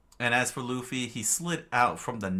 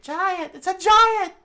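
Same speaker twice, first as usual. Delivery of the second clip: frightened; 11 dB louder than usual. Acted. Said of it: "shivered in fright".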